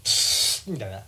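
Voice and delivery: ordinary delivery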